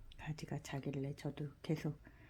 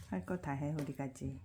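normal talking voice

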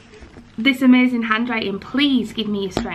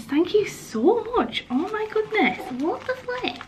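climbing much higher with each phrase, softer; rising squeal of delight, almost breaking; squealing excitement; 10 semitones higher, 3 dB quieter than usual. Real, not acted. Overwhelmed opening the ring gift.

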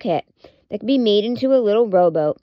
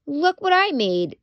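proud little lamb, pitched higher; 6 semitones higher than usual. A narrator's reading. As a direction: show off the boat with pride. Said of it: "she said proudly".

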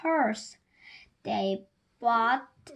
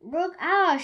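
shouting; 6 semitones higher, 4 dB louder than usual. A narrator's reading. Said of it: "shouted Harry Smith".

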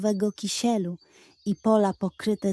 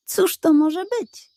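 curious wonder; 7 semitones higher, 3 dB louder than usual. Acted. Dog wonders about golden ticket.

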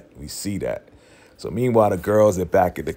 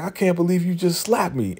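indignant quote; 9 semitones higher than usual. Retold or imitated. Molly reacting to Kelly's slap.

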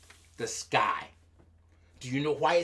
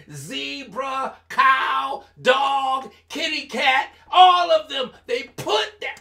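higher, louder animal list; excited roll call; 6 semitones higher, 7 dB louder, slower than usual. A narrator's reading. Storyteller listing animals joining one after another, excited. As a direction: punch out each animal, building excitement.

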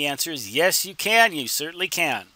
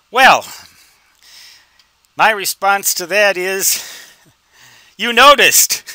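loud, higher, teasing delivery of the comeback; wry punchline; 5 semitones higher, 10 dB louder than usual. Acted. Host answers a viewer who calls antenna theory voodoo, loud wry punchline. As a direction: land the joke with a knowing punch.

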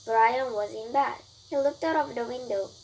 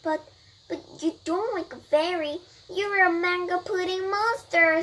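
higher, raised-pitch disbelief; startled protest; 7 semitones higher, 3 dB louder than usual. Acted. Brian confronts the mango pudding, startled. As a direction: protest in mild alarm, voice lifted.

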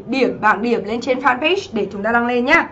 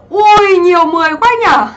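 real high, loud exclamation of amazement; delighted surprise; 6 semitones higher, 6 dB louder than usual. Real, not acted. Teacher seeing many perfect scores, high surprised exclamation.

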